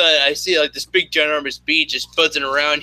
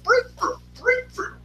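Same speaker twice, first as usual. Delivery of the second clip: very high, rapid brr-brr mouth noise; imitated game sound effect; 4 dB quieter than usual. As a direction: chirp out the game's jump sound with your lips. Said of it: "you hear the sound effects like he does".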